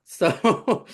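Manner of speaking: usual speaking voice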